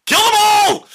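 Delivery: high, loud acted scream; screamed battle order. Villain sends the army at the walls, screamed order.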